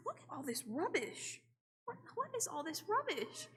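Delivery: a hint of a Brit complaining about rubbish; sounding half British without meaning to; British accent. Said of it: "I'm turning into one".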